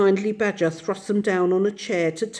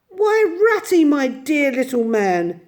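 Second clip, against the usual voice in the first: higher, delighted welcome; warm surprise; 7 semitones higher, 6 dB louder, slower than usual. Acted. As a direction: greet an old friend fondly. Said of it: "in quite a different voice".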